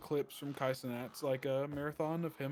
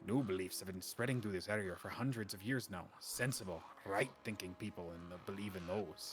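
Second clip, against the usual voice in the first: put-on almost-Irish accent; 5 semitones lower than usual. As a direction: spin a warning tale in a low almost-Irish lilt. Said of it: "where I pulled that accent out of".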